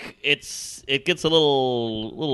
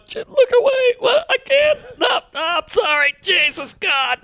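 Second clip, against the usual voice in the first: much higher, strained mock sobbing apology; mock crying man. Guy crying in front of woman, hammed-up high sob. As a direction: cry out a hammed-up ashamed apology, high and pinched. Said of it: "he's going".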